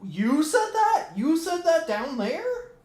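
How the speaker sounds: mock shock. Imagined dad asking kid about a word, high slow mock shock. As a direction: play up the fake surprise, slowly. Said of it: "And then I'll be like".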